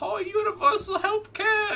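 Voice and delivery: very high, loud mock Cockney; Cockney accent. Mocking British boasts about health care, Cockney accent. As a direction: squawk it in hammed-up Cockney. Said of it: "they always word it in the Cockney accent".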